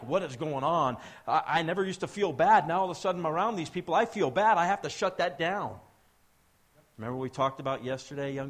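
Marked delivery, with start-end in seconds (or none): 0.0-5.8 loud, higher, agitated protest; mock panic of people unsettled by the light; freaking out. They're freaking out.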